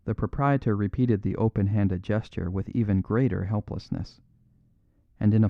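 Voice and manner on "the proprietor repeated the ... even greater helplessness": usual speaking voice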